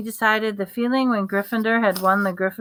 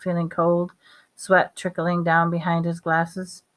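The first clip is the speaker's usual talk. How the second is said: ordinary delivery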